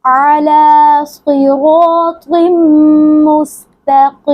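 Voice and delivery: slow recited अला सिरातिम मुस्तकीम; Quran recitation. Shown as a demonstration. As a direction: recite it drawn out. (धीमी तिलावत)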